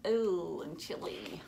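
plain reading voice

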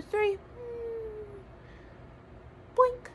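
high silly boink; cartoon landing sound; playful boink. Shown as a demonstration. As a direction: land the rocket with a high boink. Rocket landing sound effect.

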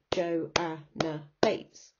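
"Joanna Bates" split into slow separate beats; half-speed counting out of "Joanna Bates"; syllable by syllable. Shown as a demonstration. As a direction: break "Joanna Bates" into Jo, a, nna, Bates. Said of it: "four syllables".

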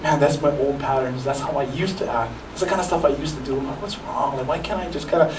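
soft, hushed plea to the Lord; quiet, humble confession; repentant prayer. Retold or imitated. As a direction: murmur a sorry prayer to God. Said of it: "repent and say".

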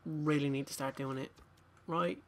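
usual speaking voice

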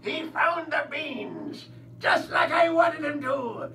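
loud, gloating pirate growl building to a shout; a cartoon pirate crowing over his scheme; pirate villain. Pirate villain gloating to himself about finding the gold, loud and hammy.

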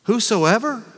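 astonished anyone could qualify; incredulous. Acted. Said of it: "incredulous at the word".

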